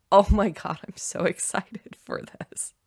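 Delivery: breathy laughing gush, trailing into a giggle; giddy excitement. Shown as a demonstration. Host showing listeners real excitement, laughing. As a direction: laugh your way through the words, thrilled. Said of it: "Can you feel the difference in the energy?".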